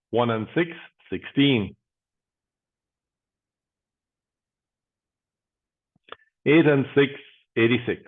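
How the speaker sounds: steady, rhythmic number calling; bingo caller. Acted. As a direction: call each number out, then pause.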